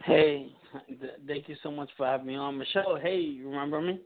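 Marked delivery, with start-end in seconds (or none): none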